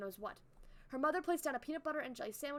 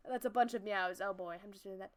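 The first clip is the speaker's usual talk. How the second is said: plain reading voice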